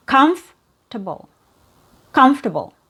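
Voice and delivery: "comfortable" as "KUMF-tuh-bul"; "comfortable" with the "or" skipped; three syllables. Shown as a demonstration. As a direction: stress "comf" and reduce the rest of "comfortable". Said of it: "you only hear three syllables".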